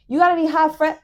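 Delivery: mocking disbelief. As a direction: throw his crude line back scornfully. Mocking the date's crude ask.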